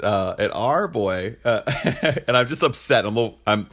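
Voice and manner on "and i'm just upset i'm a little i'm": ordinary talk, nothing marked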